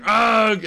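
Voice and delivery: grunt. Pretend. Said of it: "He's like".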